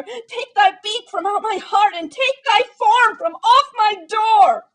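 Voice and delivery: very loud, high, slowing scream; furious shriek. A narrator's reading. Narrator screaming at the raven to leave. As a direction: drive the bird out with rage. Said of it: "I shrieked upstarting".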